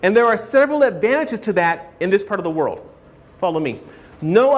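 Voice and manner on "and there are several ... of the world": usual speaking voice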